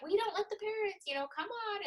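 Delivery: a light, sing-song brush-off from the teachers; imitating the teachers. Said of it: "the teachers are like".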